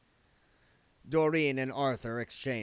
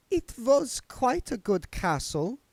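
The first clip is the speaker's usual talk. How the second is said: high, slow, affected countess voice; a lofty vampire countess, drawn out; Doreen's voice; 6 dB louder, slower than usual. A narrator's reading. Doreen's character voice.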